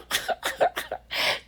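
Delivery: soft unvoiced chuckle; a quick airy laugh at her own joke; breathy laugh. Real, not acted. Crafter laughing at her own whimsy joke, airy chuckle.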